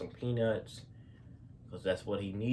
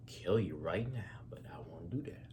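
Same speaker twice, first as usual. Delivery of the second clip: soft, low threatening whisper; menacing whisper; 3 semitones lower, 5 dB quieter than usual. Retold or imitated. Angry man warning the husband, low menacing whisper. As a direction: whisper the threat close and cold.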